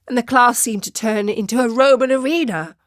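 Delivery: usual speaking voice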